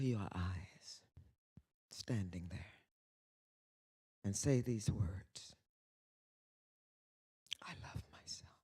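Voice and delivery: soft, low whispered guidance; guided meditation. Acted. Leading the audience in a whispered self-love exercise.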